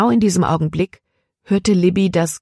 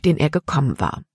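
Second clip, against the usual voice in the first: plain reading voice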